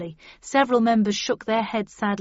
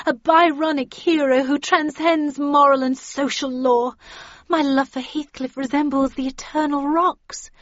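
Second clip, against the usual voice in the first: higher, loud romantic proclamation; impassioned declaration; 6 semitones higher, 5 dB louder than usual. A narrator's reading. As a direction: proclaim your love over the hecklers. Catherine declares love over barracking.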